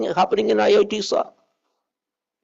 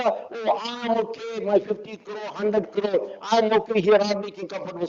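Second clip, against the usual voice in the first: softer, lazy self-satisfied voice; mock complacency; 2 semitones higher, 6 dB quieter than usual. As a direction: mimic a smug small-timer. Mocking complacent small businessmen.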